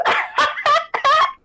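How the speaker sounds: high, wild cackling laugh; witch cackle. Acted. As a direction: cackle like a gleeful hag. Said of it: "She cackles uproariously".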